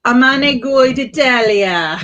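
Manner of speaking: loud, slow, Italian-flavored flourish; mock Italian flourish. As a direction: savor it like an Italian. Savoring Italy name with flourish.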